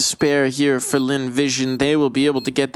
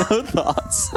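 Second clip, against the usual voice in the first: laughing. Real, not acted. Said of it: "It's the key".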